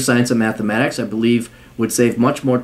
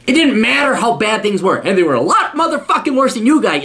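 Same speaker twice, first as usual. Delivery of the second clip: indignant, high-pitched scolding; angry rant; 7 semitones higher than usual. Real, not acted. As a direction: hammer it home, outraged. Angry rant about own youth.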